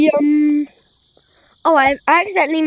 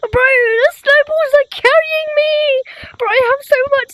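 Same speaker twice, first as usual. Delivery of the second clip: high excited yelling, laughing at end; 7 semitones higher, 3 dB louder than usual. Real, not acted. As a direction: blurt it out in disbelief. Excited over snowballs.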